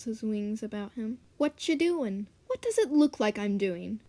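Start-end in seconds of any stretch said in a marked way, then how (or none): 1.4-2.3 casual raven voice. Acted. 2.5-4.0 loud, pouting retort. Acted. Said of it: "said Pitter with a pout".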